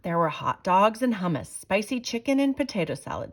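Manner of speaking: ordinary delivery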